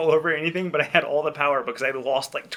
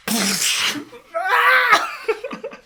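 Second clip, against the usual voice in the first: shrieking laugh; 6 dB louder than usual. Real, not acted. Bursts out laughing.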